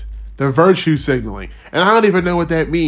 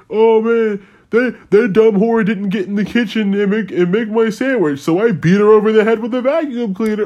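higher, hammed-up lout telling a joke; mock dumb guy joke; 7 semitones higher than usual. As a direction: ham up a crude guy's gag. Parody of guys' humor.

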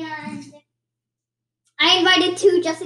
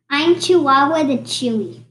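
ordinary talk, nothing marked

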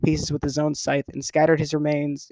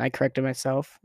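ordinary delivery

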